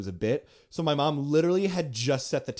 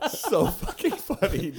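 laughing; 6 semitones higher than usual. Real, not acted. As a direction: talk while laughing. Lacht om de grap.

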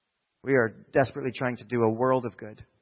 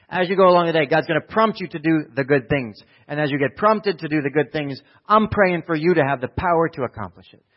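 preaching; 3 semitones higher, 7 dB louder than usual. Real, not acted. Preaching build.

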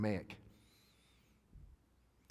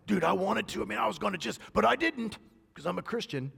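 higher mocking voice, full of pride; 10 semitones higher, 4 dB louder than usual. Preacher mocking a churchgoer who boasts of restraint, higher. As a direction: ham up the proud churchgoer, pitched higher. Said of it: "I've heard people talk like that".

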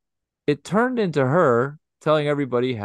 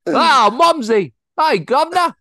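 much higher, louder, slow mock British accent; drawn-out cockney-style greeting, hammed up; 8 dB louder than usual. Greeting Mumsy and her husband, mock British accent. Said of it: "like, British".

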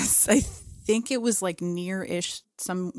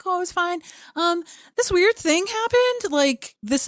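high, light retold chatter; high-voiced casual recounting; casual reply; 11 semitones higher, 5 dB louder than usual. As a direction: act out a breezy answer, pitched well up. Acts out her casual reply.

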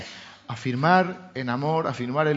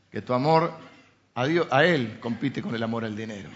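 ordinary talk

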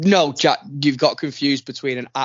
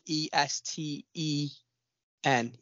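spelling out; 7 dB quieter, slower than usual. Shown as a demonstration. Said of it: "is it I or an L".

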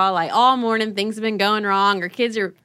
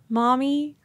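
put-on small, higher questioning child voice; lightly voiced child; 5 semitones higher than usual. Young daughter to crying mom, lightly imitated tentative voice. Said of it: "one of them says".

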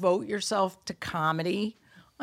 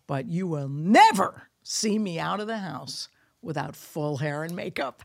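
normal talking voice

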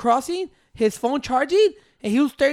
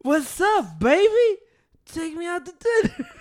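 mock flirty voice; 6 semitones higher, slower than usual. Mocking a TikTok voice.

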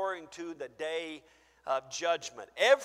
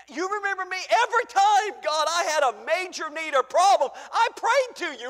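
higher, louder insistence; pleading believer; 12 semitones higher, 12 dB louder than usual. Acted. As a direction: remind God over and over. Acted plea at judgment.

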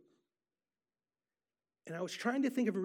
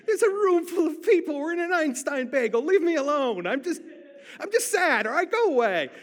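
loud, high, pleading protest; desperate scream; 7 semitones higher, 8 dB louder than usual. Retold or imitated. Speaker screams inwardly at a stranger offering prayer, desperate. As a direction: cry out in desperation, much higher and louder. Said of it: "I desperately wanted to scream".

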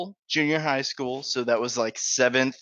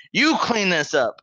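slow, higher, louder janitor order; angry janitor; 7 semitones higher, 5 dB louder, slower than usual. Retold or imitated. Janitor ordering the kid to clean, angry. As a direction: bark the order like a furious janitor. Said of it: "and he was pissed".

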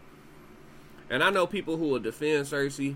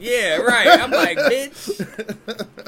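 mock scoff; 10 semitones higher, 12 dB louder, much slower than usual. Acted mocking reaction, laughing.

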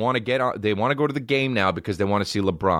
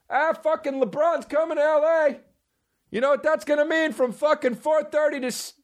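put-on much higher, griping New York accent dad. A New York dad griping about LeBron and traffic. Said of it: "I have a New York accent".

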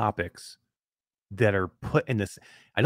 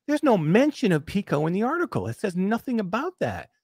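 retold outrage; 8 semitones higher than usual. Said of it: "I'm like".

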